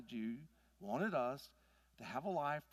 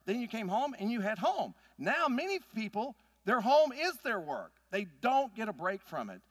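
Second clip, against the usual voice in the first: loud, high, driving sermon voice; full-force preaching; 6 semitones higher, 7 dB louder than usual. Acted. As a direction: preach it hard and build.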